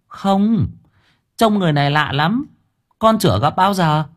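put-on a boy describing a strange man; young boy answering. A narrator's reading.

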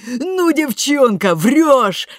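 high, loud, slow squeal; squealing disbelief. A narrator's reading. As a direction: squeal with delight. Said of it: "Бренда взвизгнула".

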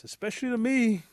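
high, loud pointed emphasis; pointed warning. Acted. Wife to Rabbit about keeping promises, pointed warning. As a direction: lean hard on it as a warning.

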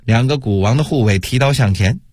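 usual speaking voice

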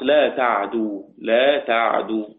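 "لا تعدو" slow, silent ayn and single dal; Hafs's light dal. Shown as a demonstration. Teacher showing student Hafs's reading, slow "لا تعدو" with light dal. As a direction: recite "لا تعدو" slowly without doubling the dal. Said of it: "خفف الدال".

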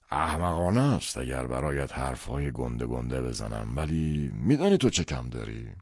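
slow, deliberate paternal voice, key words stretched; lecturing father. Acted. Father tells son what he lacks, slow and lecturing. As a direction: lecture weightily, drawing out the final words.

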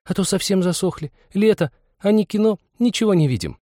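put-on high-pitched girlish complaint; lively, piteous girl's voice; plaintive girl. A narrator's reading. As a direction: whine playfully for sympathy. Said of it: "весело и жалостливо другая".

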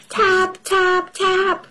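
high tapping sound effect; tap noise. Acted. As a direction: tap out each word high. Typing noise imitated.

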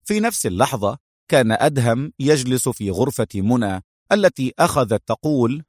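ordinary delivery

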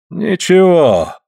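high, slow stretched reassurance; drawn-out. A narrator's reading. Suль checks his hurt hand, drawn-out reply. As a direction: stretch the word right out. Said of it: "протяжно говорит он".

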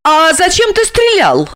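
high-pitched female character voice; high, insistent question; questioning woman. A narrator's reading. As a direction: press him for a reason, pitched high. Olga questions Georgy about shooting.

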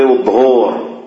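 الظهور with a long u, "adh-dhuhoor"; colloquial mispronunciation. Shown as a demonstration. Teacher quoting everyday speech, الظهور with an added long u. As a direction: stretch الظهور into two syllables, dhu-hoor. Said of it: "هذا لا أصل له في اللغة".

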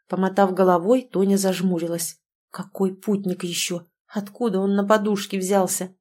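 plain reading voice

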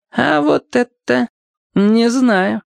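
put-on high, slow old man's voice; cagey old man. A narrator's reading. Old cobbler won't promise the tale is real, cagey. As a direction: draw it out slowly, pitched high.

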